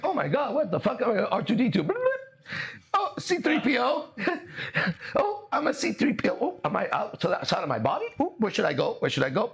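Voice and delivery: high, bewildered squeaks of a waking robot; confused droid voice. Retold or imitated. The dissolving self discovers it is a robot, high and baffled. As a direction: wonder aloud, lost, like a freshly booted droid. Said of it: "would still be like".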